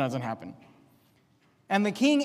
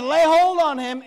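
louder, higher angry order; upset king; 5 semitones higher, 7 dB louder than usual. Retold or imitated. Jeroboam upset, ordering men to grab the man of God, angry shout. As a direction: snap it out as an enraged ruler. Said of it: "Jeroboam was upset".

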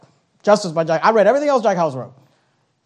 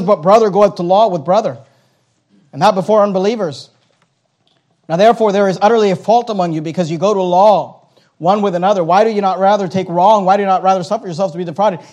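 raised, steady pulpit reading; preached scripture; 5 dB louder than usual. Acted. Pastor reading Paul to the congregation, raised. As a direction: read it with weight.

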